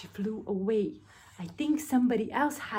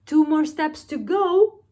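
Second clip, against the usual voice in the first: thief voice; 6 semitones higher, 8 dB louder than usual. Acted. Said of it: "said Hugh".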